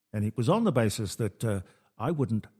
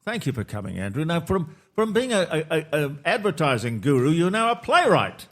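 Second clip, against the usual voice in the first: lifted, louder on-air delivery; radio host voice; 5 semitones higher, 4 dB louder than usual. Real, not acted. Host welcoming the playwright guest on air, projected presenter voice. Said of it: "host introducing on air".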